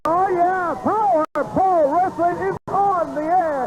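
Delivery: high-pitched, slow show-opening announcer call; big wrestling-show intro; announcer opener. Acted. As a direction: open the broadcast like a ringside host.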